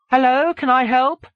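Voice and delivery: very high, loud phone greeting; secretary. A narrator's reading. Secretary answering an author's call, high and loud. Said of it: "Kerris' secretary said".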